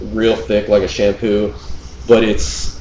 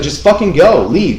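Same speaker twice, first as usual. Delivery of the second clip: retold angry dismissal; 8 semitones higher, 4 dB louder than usual.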